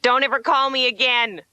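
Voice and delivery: mock furious parting shout; retold scream. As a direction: yell after a departing car, hammed-up. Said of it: "I was screaming after it".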